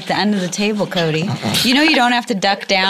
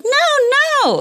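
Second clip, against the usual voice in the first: high-pitched no; 3 dB louder than usual. Retold or imitated. Retelling a refusal, jokey.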